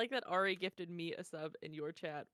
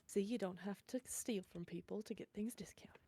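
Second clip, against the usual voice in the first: hushed, breathy whisper to a companion; 7 dB quieter than usual. Acted. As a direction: whisper it sideways as an aside. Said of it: "she's gonna whisper to Owen".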